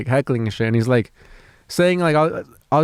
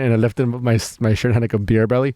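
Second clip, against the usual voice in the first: ordinary talk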